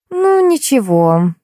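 high, drawn-out, faintly disapproving; slight disapproval. A narrator's reading. Actress judges the wig work, slightly disapproving. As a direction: grant it slowly, not quite satisfied. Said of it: "с лёгким неодобрением отметила".

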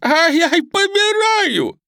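very high, loud drawn-out wail; frantic screaming. Acted. Hostage woman crushed in window, screaming frantically. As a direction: shriek as if being crushed. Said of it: "истошно кричала та".

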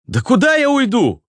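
high-pitched shout back; calling back. A narrator's reading. Answers man calling from door. (высокий ответный выкрик)

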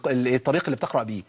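ordinary delivery

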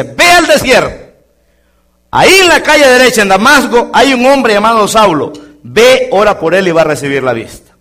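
high, loud voice of God; God commanding. Acted. God sends Ananias to Saul in a loud commanding voice. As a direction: announce the command from heaven.

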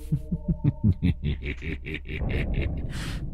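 put-on a ghost laughing through the girl; eerie cackle. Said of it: "như đang cười khanh khách".

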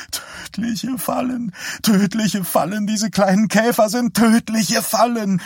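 very high, stretched, slow then rising; mother keeps repeating her fear of small cars, high and stammering; stammering hysterical mother. Acted. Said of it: "stammelte Vera".

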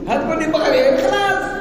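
very high, loud mock triumph; high loud mock gloat; triumphant retort. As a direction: crow it out in a squeaky high voice.

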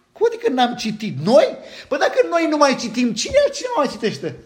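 high, loud, offended mock protest; hammed-up huffy Pharisee; indignant Pharisees. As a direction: play the outraged Pharisee, laughing at the idea. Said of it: "Ar fi putut spune fariseii".

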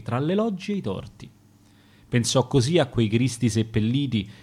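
usual speaking voice

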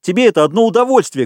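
high, quick accusing cry; heated, indignant yell; angry shouting. A narrator's reading. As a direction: hurl the reproach, fast and high. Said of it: "кричал Ральф".

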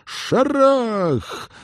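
high, loud drawn-out exclamation; shouted bang. A narrator's reading. Narrator voices a blast hitting the ground, shouted bang. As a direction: burst out like a sudden explosion.

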